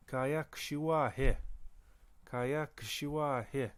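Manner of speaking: Kaya kshua he drawn out slowly; slow demo. Shown as a demonstration. Teacher modelling a Michif command, slowly. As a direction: say it slowly so learners catch it. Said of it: "teacher models phrase slowly".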